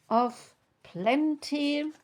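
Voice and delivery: normal talking voice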